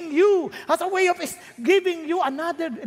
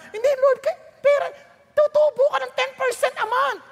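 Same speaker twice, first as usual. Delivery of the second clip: pleading believer; 10 semitones higher than usual. Acted. Believer pleading with God over investment.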